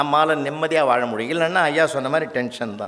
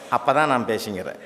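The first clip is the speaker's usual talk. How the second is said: ordinary talk, nothing marked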